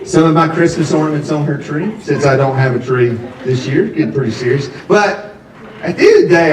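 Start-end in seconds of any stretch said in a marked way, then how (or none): none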